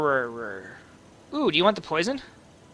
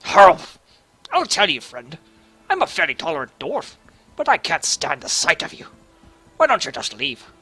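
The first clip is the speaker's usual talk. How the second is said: gruff mock dwarf accent, louder; hammed-up dwarf telling off a half ogre; Dwarven accent; 4 dB louder than usual. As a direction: play the grumpy dwarf who can't stand you. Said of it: "I've lost my Dwarven accent".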